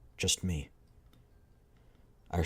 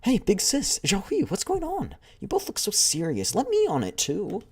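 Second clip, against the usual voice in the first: much higher, bouncy little-sister voice; cheeky younger girl; 14 semitones higher, 6 dB louder than usual. Acted. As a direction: barge in like an eager kid sister. Playful younger squadmate butting in.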